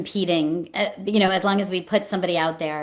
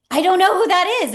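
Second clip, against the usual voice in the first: much higher, louder, bewildered; baffled surprise; 13 semitones higher, 7 dB louder than usual. Retold or imitated. Staffer admitting she doesn't know the winner, bewildered and loud. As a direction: blurt your confusion. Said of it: "But it was like".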